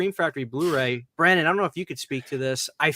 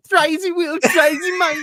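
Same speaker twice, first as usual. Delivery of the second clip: high, louder put-on leprechaun voice; imitating the leprechaun ad; 13 semitones higher, 7 dB louder than usual. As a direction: belt the jingle like a cheeky leprechaun. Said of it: "they're doing the".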